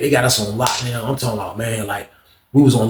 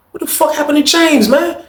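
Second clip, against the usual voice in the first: much higher, alarmed question; urgent high demand; 14 semitones higher than usual. Retold or imitated. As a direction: demand answers right away. Storming into friend's cell.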